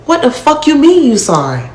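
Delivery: retold outburst at the man who let it happen; retold angry outburst. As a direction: confront him again, voice up high and heated. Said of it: "So I'm stressing".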